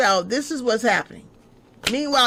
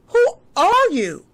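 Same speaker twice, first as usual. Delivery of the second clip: mocking question; 8 semitones higher, 4 dB louder, slower than usual. Mocking the unknown liar.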